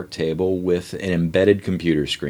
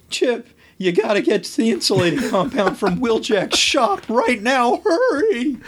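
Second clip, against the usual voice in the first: put-on urgent teen voice. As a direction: rush him like a panicked kid.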